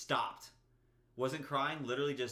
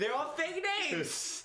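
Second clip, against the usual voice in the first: high, drawn-out protest; exasperated insisting; 12 semitones higher, slower than usual. Real, not acted. Storyteller defending to teasing co-host, high drawn-out protest. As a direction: insist in a slow, high-pitched wail.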